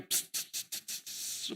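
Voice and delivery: whispered spray hiss; spray sound. Shown as a demonstration. Preacher imitates perfume spraying, soft hissing pss. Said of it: "Disemprot parfum".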